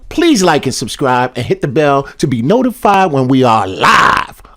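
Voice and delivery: loud hyped outro read. Acted. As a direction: pitch the subscribe plea hard.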